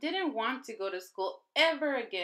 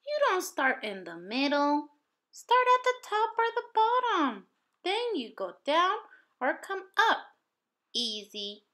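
bossy little helper; 7 semitones higher, 5 dB louder than usual. A narrator's reading. Sharon teaching coat buttons.

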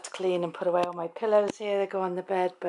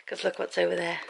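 usual speaking voice

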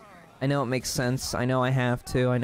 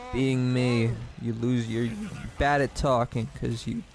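normal talking voice

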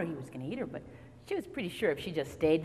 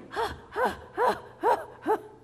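long gasp; 13 semitones higher, 5 dB louder than usual. A narrator's reading. Girl safe inside.

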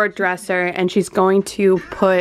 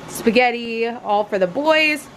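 usual speaking voice

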